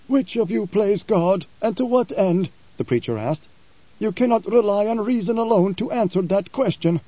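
a lightly voiced preacher pressing his question; blind preacher. Blind preacher challenging Halleck, probing.

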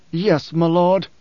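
taut, held-back anger; restrained fury in a loyal reply; suppressed rage. A narrator's reading. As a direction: answer with a berserker's rage kept under. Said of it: "suppressed rage of a berserker".

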